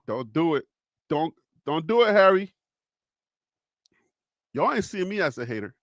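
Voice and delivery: ordinary delivery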